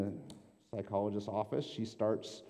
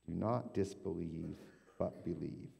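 quieter, lower, gently repeated; soft repeat; 3 semitones lower, 6 dB quieter than usual. Shown as a demonstration. Pastor repeats Jesus to the congregation, soft and low. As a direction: let the words sink in softly. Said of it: "pastor repeats the line softly".

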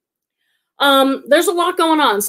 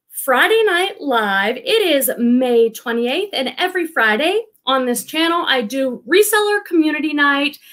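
normal talking voice

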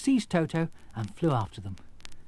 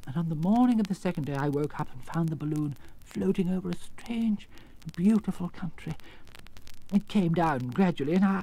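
lightly voiced, higher little-man storyteller; meek little man; 7 semitones higher than usual. Exposed wizard telling visitors his story, meek. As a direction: recount it in a mild, raised voice.

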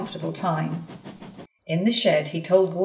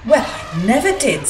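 astonished foreman; 5 semitones higher, 7 dB louder than usual. Acted.

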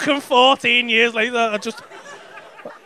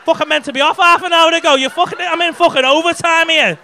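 louder hammed-up rant; a mock-furious rant to the crowd about overtime; mock fuming; 4 semitones higher, 7 dB louder than usual. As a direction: play the put-upon comic losing patience. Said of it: "I'm fuming here".

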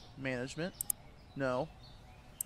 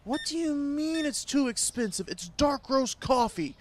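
higher, louder indignant rant; mock rant; 8 semitones higher, 3 dB louder than usual. Shopkeeper scolding a customer who calls coffee expensive, mock angry rant. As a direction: scold the cheap customer in hammed-up outrage. Ranting at stingy game customer.

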